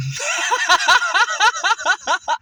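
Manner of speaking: loud high cackling laugh; loud laughter. Real, not acted. Remembering the comic bombing, loud high laughter. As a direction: burst out laughing.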